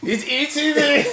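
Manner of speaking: laughing. Real, not acted. Being eaten in game.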